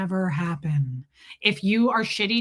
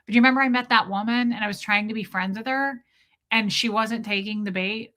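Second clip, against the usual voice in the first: ordinary talk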